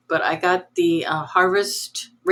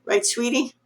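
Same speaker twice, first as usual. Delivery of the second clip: sweet dog talk; 6 semitones higher than usual. Real, not acted. Talking to her dog.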